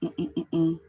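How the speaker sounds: short repeated hums on one note; idle humming. Real, not acted. Host waiting for viewers to join, humming idly. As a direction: hum absently to fill the wait.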